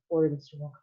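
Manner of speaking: ordinary delivery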